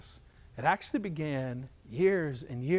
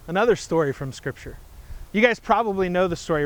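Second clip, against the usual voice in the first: story narration; 7 dB louder than usual. Acted. Dramatic storytelling of Adam and Eve.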